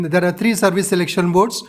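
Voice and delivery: ordinary talk, nothing marked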